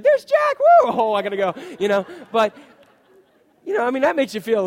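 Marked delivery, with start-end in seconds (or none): 0.0-1.5 high, loud squeal of joy; gleeful greeting. Pretend. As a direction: shriek with delight at seeing Jack. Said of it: "came into church and said".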